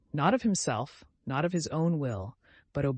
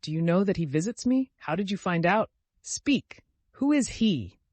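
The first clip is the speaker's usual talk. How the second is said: frantic questioning; 5 semitones higher than usual. A narrator's reading.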